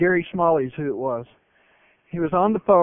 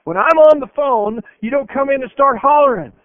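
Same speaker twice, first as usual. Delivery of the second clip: louder, higher scolding; dad scolding his son for interrupting; angry father; 7 semitones higher, 10 dB louder than usual. Retold or imitated. Father scolding his boy after slamming down the phone, raised angry voice. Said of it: "He says".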